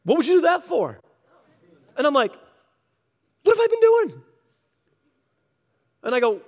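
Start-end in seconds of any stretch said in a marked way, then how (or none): 0.0-1.0 high, loud, puzzled challenge; incredulous retort. Retold or imitated. Skeptical man retorting in story. 3.4-4.2 much higher, louder, a strained put-on voice; shocked realization. Preacher's self-reproach in story.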